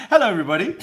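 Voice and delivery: fast, bright stream opener; bouncy host welcome; hype greeting. Real, not acted. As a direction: rush out a cheery hello to viewers.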